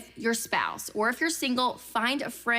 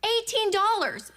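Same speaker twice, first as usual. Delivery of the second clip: much higher, disbelieving repeat; shocked; 11 semitones higher than usual. Retold or imitated. Repeating the lemonade price to the audience, shocked. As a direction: let the shock land fully.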